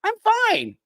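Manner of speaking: mock fine. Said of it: "pretend like".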